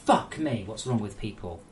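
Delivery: normal talking voice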